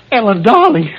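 high, loud desperate plea; anguished. Acted. Man calling to his unresponsive girlfriend, anguished. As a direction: beg her to answer.

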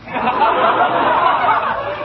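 long, high-pitched stage laugh; high acted laughter. Boss laughs as visitor arrives.

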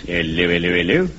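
puzzled bobby's "Hello, hello"; policeman hello. Acted.

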